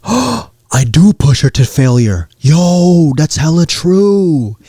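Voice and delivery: hammed-up bro revelation; amazed realization. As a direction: exclaim it like everything just clicked. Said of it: "his eyes open up like".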